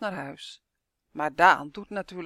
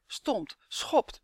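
plain reading voice

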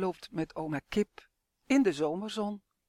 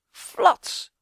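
splash sound; 11 semitones higher, 10 dB louder than usual. A narrator's reading. Wave hits chick.

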